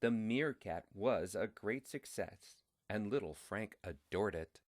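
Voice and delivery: ordinary delivery, nothing marked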